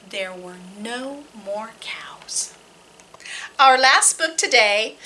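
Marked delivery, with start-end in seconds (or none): 0.1-2.5 whisper. Acted. Reader closing a story to children in a whisper. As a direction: let the last line fade to a whisper.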